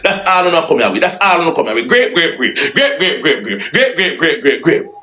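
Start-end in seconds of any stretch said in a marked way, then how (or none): 1.9-5.0 sarcastic laughing great. Acted. Sarcastic repeated praise, laughing.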